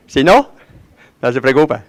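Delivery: normal talking voice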